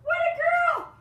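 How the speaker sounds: high squeaky praise for a dog. Real, not acted. Praising the dog, pitch leaps.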